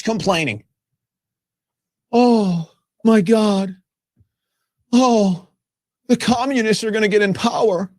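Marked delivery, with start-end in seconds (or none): 2.1-7.9 whiny, sing-song "oh my gosh"; mock panic. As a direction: ham up the fearful complainers. Mocking panicked complainers.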